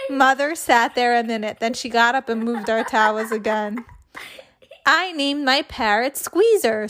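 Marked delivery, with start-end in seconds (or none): none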